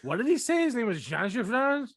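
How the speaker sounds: high puzzled question; confused. Retold or imitated. Viewer baffled by the name Jean Valjean, high and puzzled. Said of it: "you're like".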